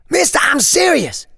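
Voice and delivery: put-on boy pleading loudly with the stranger; urgent shout. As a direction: yell it desperately. Boy urging stranger in danger.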